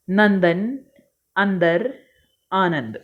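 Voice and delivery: each word set apart, full n sound; clear bindu words. Shown as a demonstration. Teacher modelling bindu words for pupils. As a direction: say नंदन, अंदर, आनंद with a clear n. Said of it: "बिंदु वाले शब्द हैं".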